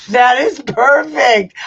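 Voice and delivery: loud, high, drawn-out delighted cry; thrilled shout. Real, not acted. Laughing at her own shirt idea, shouting in delight.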